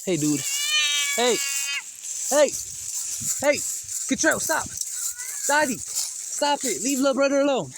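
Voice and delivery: high loud shouts; shouting at the cat to leave the other alone. Real, not acted. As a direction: break up a fight. Breaking up cat fight.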